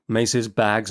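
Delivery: plain reading voice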